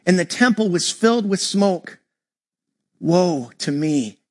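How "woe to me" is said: low, slow, drawn-out lament; anguished cry. A narrator's reading. Pastor reading Isaiah's cry before God, low slow lament. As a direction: let the woe sink low and slow. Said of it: "I cried".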